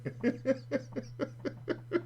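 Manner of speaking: high, wheezing long laugh; real cackle. Real, not acted. Storyteller remembering the tournament outburst, real cackling. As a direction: crack up helplessly at your own story.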